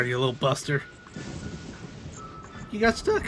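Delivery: ordinary delivery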